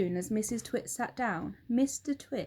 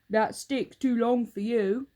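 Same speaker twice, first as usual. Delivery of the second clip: Mister Twit; 7 dB louder than usual. Acted. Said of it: "Mister Twit said to her".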